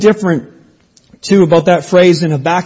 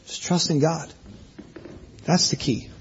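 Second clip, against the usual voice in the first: hushed aside; 2 semitones lower, 14 dB quieter than usual. Real, not acted. Pastor confiding the key to the congregation, much softer and hushed. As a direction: drop almost to a murmur, much softer.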